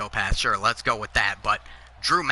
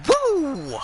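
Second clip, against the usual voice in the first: high loud falsetto woo; Flair woo; 5 dB louder than usual. Acted. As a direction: punctuate the threat with a ringing woo. Voicing Flair in a promo.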